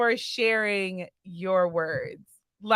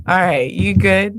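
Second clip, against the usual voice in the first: ordinary talk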